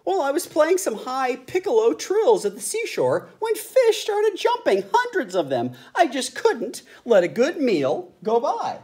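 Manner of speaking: high, squeaky bird voice; high pelican voice. A narrator's reading. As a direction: chatter as a pelican.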